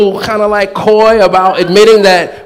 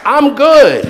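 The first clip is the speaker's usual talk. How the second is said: higher, laughing, breezy reassurance from heaven; carefree departed soul; 7 semitones higher, slower than usual. Retold or imitated. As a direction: toss it off like someone who's totally fine. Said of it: "They're like".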